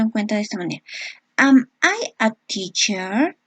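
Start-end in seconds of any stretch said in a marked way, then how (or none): 1.4-3.4 slow, careful English question; slow model question. Shown as a demonstration. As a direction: read it out slowly for students. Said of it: "teacher modeling question slowly". (pregunta modelo lenta y clara)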